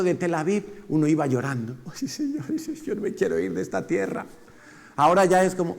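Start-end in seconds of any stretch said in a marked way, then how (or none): 1.9-4.2 mock weeping. Crying on the bus from Israel.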